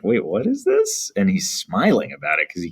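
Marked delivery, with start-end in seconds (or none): 0.0-1.1 high, puzzled voicing of the narrator; confused surprise. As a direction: play the narrator baffled by the bird. Teacher voices confused narrator.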